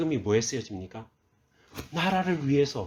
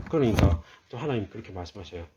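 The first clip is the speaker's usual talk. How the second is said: usual speaking voice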